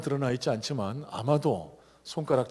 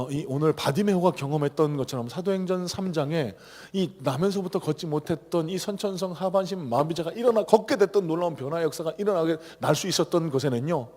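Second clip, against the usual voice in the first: ordinary talk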